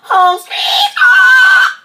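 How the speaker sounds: loud squealing horn imitation; slow, screeching mock saxophone; saxophone blare. A narrator's reading. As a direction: blast it very high like a honking sax. Narrator imitates saxophone blast.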